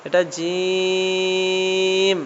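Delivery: জিম long and slow like a recitation; drawn-out letter name. Shown as a demonstration. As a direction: stretch out জিম slowly, Quran-lesson style. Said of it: "এটা হচ্ছে জিম".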